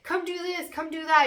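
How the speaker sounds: high, coaxing nag; nagging voice. Retold or imitated. Reader voicing the inner nagging voice, high coaxing nag. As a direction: tempt them lightly. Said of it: "that nagging voice".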